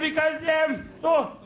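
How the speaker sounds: high innocent schoolboy excuse; mock innocent boy; cheeky schoolboy. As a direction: play the earnest little pupil. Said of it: "I said".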